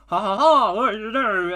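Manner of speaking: loud, high mock-French villain laugh; bad French accent. French sled racer taunting rivals, bad French accent. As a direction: cackle like a hammed-up French racer. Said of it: "French accent is very".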